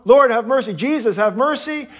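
high-pitched urgent plea. Acted. Blind beggar calling over the crowd, crying out. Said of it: "really, really loud".

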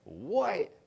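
high-pitched, astonished "what?"; incredulous. Retold or imitated. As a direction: react in mild retold disbelief, pitch jumping up. Retold astonished reaction in a story.

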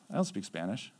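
low, soft matter-of-fact denial; quiet denial. Retold or imitated. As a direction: say it quietly and low, almost in passing. First, casual denial in retold chat.

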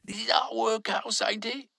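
a puzzled worker calls out; calling out. A narrator's reading. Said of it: "Another called out".

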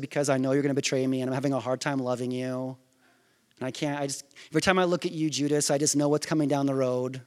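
low, hurried awkward admission; mock confession. Mock dialogue of Jesus rejecting Judas.

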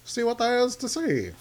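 put-on high, nasal-free old New York gangster voice; old-timey New York accent. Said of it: "What accent is that?".

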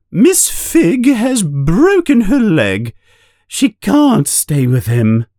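a high flustered announcement; upset aunt. A narrator's reading. Aunt telling the family the sitter is hurt, flustered. Aunt reports phone news.

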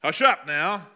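stern, loud "Hush up now"; harsher rebuke. Acted. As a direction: snap it as the harsh version. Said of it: "probably a little harsher than that".

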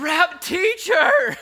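very high, loud and slow; ecstatic cry. Acted. Mary recognizing her teacher, ecstatic slow cry. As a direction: stretch it out in overwhelmed joy.

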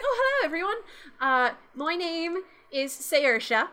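tiny peppy elf, starting high; little elf with an Irish lilt. Acted. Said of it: "and she's very peppy".

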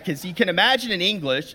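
usual speaking voice

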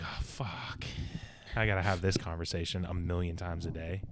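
low-energy, softened exasperated grumble; acted quiet dread of small talk; weary resignation. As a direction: sigh it out under your breath. Tall person's weary inner reaction.

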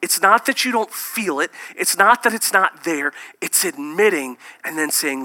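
usual speaking voice

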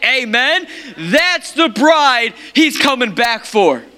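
building to a high, loud pulpit climax; full-force preaching. Real, not acted. Preacher proclaims Christ's dragon-slayer bride, full-force preaching. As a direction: hammer each phrase, climbing in pitch and force.

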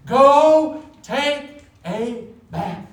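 loud, high, very slow order; emphatic parental command; stern command. Acted. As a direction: order the kid, one drawn-out word at a time. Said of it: "my next command is".

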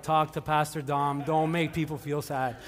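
slow, low, measured elder voice; droning church elders. Acted. Preacher imitates elders correcting Pastor Dom, slow and low. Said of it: "Our elders are like".

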